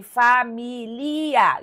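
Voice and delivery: "família" em fa-mi-li-a, devagar, com quatro sílabas; desfaça o ditongo final de "família": fa, mi, li, a; separação de Portugal. Shown as a demonstration. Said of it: "Lá em Portugal, a prevalência". ("família" silabada à portuguesa, como proparoxítona)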